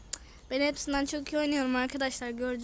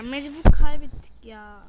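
loud, fed-up cry at the loss; frustrated outburst; 16 dB louder than usual. Real, not acted. Gamer telling viewers they lost, loud frustrated outburst. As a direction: blurt out the defeat in exasperation.

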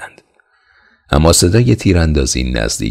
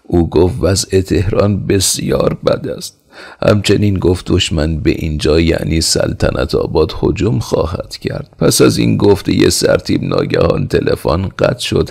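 ordinary delivery

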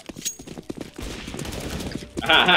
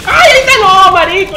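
shouting; 11 semitones higher, 11 dB louder than usual. Real, not acted. Enemy appears, shouts.

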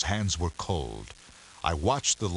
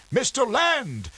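put-on high, slow, strained old captain; strained mutter; 5 dB louder, slower than usual. A narrator's reading. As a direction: force the words out with difficulty. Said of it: "muttered the captain with great difficulty".

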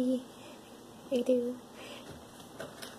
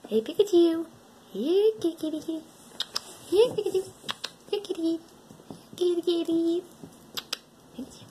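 high sing-song kitty calling; calling a shy cat, higher and cooing; coaxing cat call; 7 semitones higher than usual. Real, not acted. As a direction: coax the cat over in a high voice.

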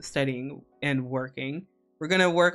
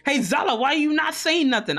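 mock call-out; 9 semitones higher, 4 dB louder than usual. Imagined streamer calling her out.

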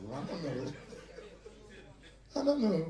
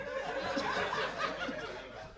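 high laugh; 6 dB quieter than usual. Real, not acted. Laughing after trainer quote.

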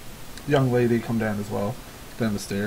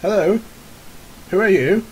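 higher, louder questioning villager at the door; voiced villager answering a knock; wary householder; 6 semitones higher, 4 dB louder than usual. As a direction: call out to the strangers on the step. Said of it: "somebody kinda comes out".

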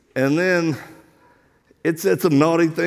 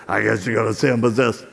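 low, quicker replay of the demon's voice; imitated demon voice; 8 semitones lower, faster than usual. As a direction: mimic the possessed girl, low and fast. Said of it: "looks at me and goes".

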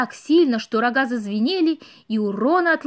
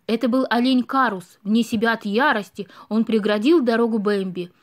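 usual speaking voice

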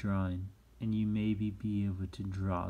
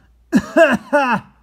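loud hammed-up cough; pretend cough; 20 dB louder than usual. Teacher demonstrating a cough for manners, pretend cough. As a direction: cough hard and showily on purpose. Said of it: "or a cough".

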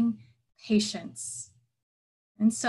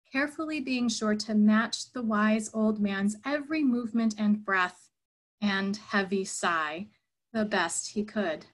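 ordinary delivery

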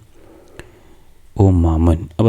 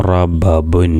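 slow blending; much slower than usual. Shown as a demonstration. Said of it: "എങ്ങനെ കൂട്ടി വായിക്കും?".

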